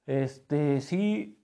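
talking through a yawn; words stretched by a yawn. Real, not acted. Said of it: "Perdón, otro bostezo".

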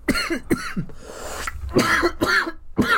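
harsh rasping cough fit. Real, not acted. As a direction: hack and cough hard. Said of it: "I cannot stop coughing".

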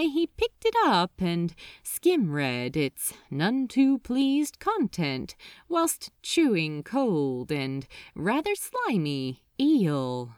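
ordinary delivery

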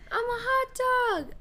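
high-pitched mock TikTok voice; imitating TikTok sound. Imitating a TikTok sound for a co-host, high-pitched mimicry. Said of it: "But it's like".

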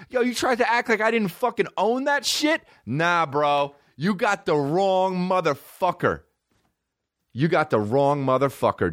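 high-pitched, swaggering comic outrage; mock indignant confrontation. Comic confronts the club manager over his story, mock indignant. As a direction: square up to him, voice pushed high.

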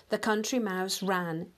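usual speaking voice

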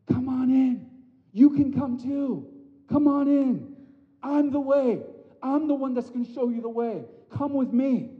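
high, loud warm invitation; welcoming Jesus. Retold or imitated. Jesus inviting people to the Father, high and welcoming. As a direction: beckon everyone in with open arms. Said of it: "He's like".

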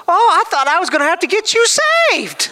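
put-on high, amused older woman voice with a laugh; a laughing old lady. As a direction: tease him laughing like a sweet auntie.

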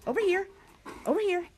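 high, short repeated calls; calling a dog. Real, not acted. Owner calls doggy back, high coaxing. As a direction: coax the pet over, pitched high.

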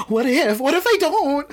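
high, gasping panic over losing love; panicked what if. Retold or imitated. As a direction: spiral into sudden mock panic, pitch shooting up. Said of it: "I get crazy and I'm like".

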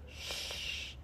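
soft, voiceless shush; hushing. A narrator's reading. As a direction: shush as if keeping a secret. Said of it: "held a finger to his lips".

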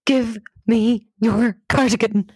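slow, emphatic plea, word by word; urgent demand. Retold or imitated. Panicking volunteer to her friend, slow urgent demand. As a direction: demand it slowly and forcefully.

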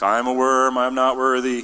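loud, droning mock lament; mock self-abasement. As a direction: wail your unworthiness in jest. Mocks self-abasing worm theology.

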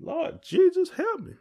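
very high hammed-up cry for help; mock panicked plea. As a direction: squeal to the Lord while falling. Retelling slipping on ice.

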